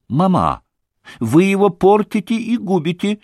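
lightly voiced young girl protesting from behind a door; young girl reproaching her mother through a door; thin little voice. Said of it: "послышался тоненький голосок Лизе".

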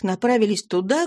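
ordinary delivery, nothing marked

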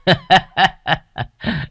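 high gloating evil laugh; high evil cackle. Acted. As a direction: cackle with greed. Villain gloats over deaths.

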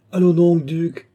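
loud, high-pitched amused outburst; laughing exclamation. A narrator's reading. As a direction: cry out in merry disbelief. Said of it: "s'écria la comtesse en riant aux éclats". (s'écrier d'une voix haute et amusée)

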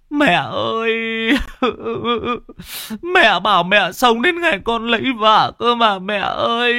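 high, loud sobbing cry; wailing in grief. Acted. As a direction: howl out the pain of loss. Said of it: "chú Rêu gào mồm lên mà choe choé, đau đớn".

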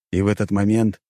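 usual speaking voice

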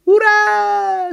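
very high, loud hurrah; drawn-out cartoon cheer; yelling monkey. A narrator's reading. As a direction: squeal with joy as a monkey. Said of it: "закричала «Почешись»".

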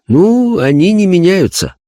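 high, earnest medium voice; a spirit medium describing a vision; seance medium. Acted. (голос медиума на сеансе)

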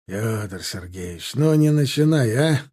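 brushing him off; dismissive. A narrator's reading. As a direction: wave off his complaint. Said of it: "отмахнулся майор".

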